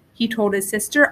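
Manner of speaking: usual speaking voice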